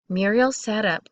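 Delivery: usual speaking voice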